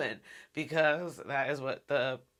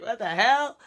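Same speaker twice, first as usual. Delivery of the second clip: higher and louder, disgusted outburst; shocked what the hell; 6 semitones higher, 8 dB louder than usual. Retold or imitated. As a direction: blurt it out, grossed out. Reacting to chin-licking scene.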